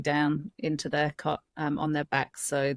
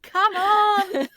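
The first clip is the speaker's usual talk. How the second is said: exasperated plea; 5 dB louder than usual. Retold or imitated. Baby won't close her eyes.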